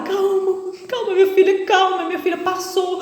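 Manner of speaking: soothing. Retold or imitated. Church brothers calming the crying woman, soothing. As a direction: soothe a frightened girl.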